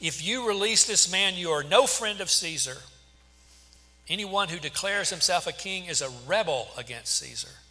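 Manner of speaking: loud, high-pitched accusation; shouting. A narrator's reading. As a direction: shout it as a forceful warning.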